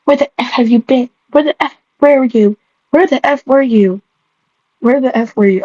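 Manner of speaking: plain reading voice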